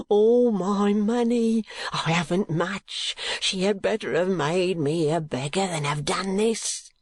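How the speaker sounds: high, slow lament; anguished old miser. Acted. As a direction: moan over the loss. Gride despairing.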